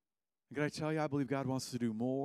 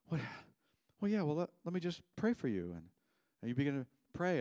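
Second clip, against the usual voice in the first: usual speaking voice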